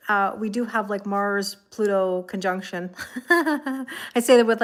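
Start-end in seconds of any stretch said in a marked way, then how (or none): 3.1-4.6 giggling nervously through the words; nervous laugh. Real, not acted. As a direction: laugh nervously as you speak. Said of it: "like, a nervous laugh and giggle".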